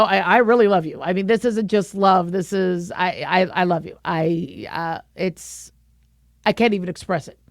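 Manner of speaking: tenderly at a loss for words; romantic declaration to husband. Acted. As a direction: drop low and fumble through a heartfelt confession. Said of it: "I use different tones".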